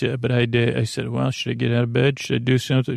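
ordinary talk, nothing marked

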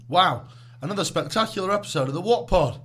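loud, high show-host hype; hype outro. Acted. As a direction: announce it like a big finish.

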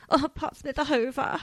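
playing a posh lady asking for the hoover, light put-on accent; odd put-on accent. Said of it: "I don't know what accent that was".

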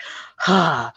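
a pointed, put-upon sigh; heavy mock sigh of impatience; passive aggressive sigh. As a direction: sigh to make him feel guilty. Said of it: "passive aggressive sighing".

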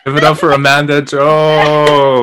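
slow, drawn-out hype intro; announcer voice. Acted. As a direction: boom it out like a game show host. Host introduces guest, hype.

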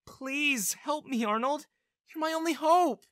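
high, drawn-out desperate plea; begging. A narrator's reading. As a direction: implore slowly, pitch climbing at the end. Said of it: "she begged".

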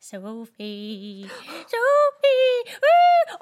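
eerie call rising to a loud high wail; ridiculous prank voice. Retold or imitated. Imitating flatmate's recorded wake-up prank, ridiculous rising voice. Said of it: "him doing a ridiculous voice".

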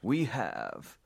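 slow, drawn-out fight announcer call; ring announcer. Acted. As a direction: introduce the blue corner like a boxing MC.

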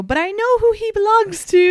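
high, loud, sing-song mock claim; sassy claim. Wife teasing flirting woman, high mock claim. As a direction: stake the claim with a playful high flourish.

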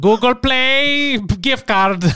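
slow, loud mock Indian scammer; Indian scammer accent. Joking about gift card scams, slow mock Indian accent. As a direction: drag it out like a scam caller. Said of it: "doing an Indian impression".

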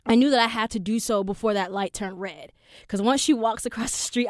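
ordinary delivery

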